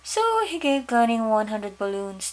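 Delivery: usual speaking voice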